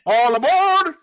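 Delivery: high, loud ship's call; mock sailor call. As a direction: bellow it like a ship's captain.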